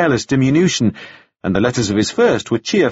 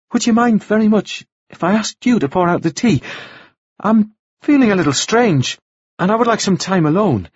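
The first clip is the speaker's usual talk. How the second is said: higher-pitched voice for a woman asking politely; Anna's polite request; 9 semitones higher than usual. A narrator's reading. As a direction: pitch it up, asking to be left alone. Anna asks reverend to leave.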